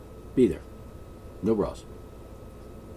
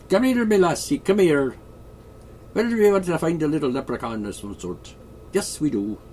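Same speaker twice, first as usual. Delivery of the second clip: put-on Irish-Scottish accent; 5 semitones higher than usual. Ruler showing off the accents heard in the palace, mock Irish-Scottish. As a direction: play it broadly Irish-Scottish, calling out then easing down. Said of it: "an Irish and Scottish accent".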